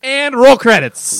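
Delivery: high, loud, drawn-out call; mock announcer call. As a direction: call it out like a film's big finish. Mock film director ending the sketch.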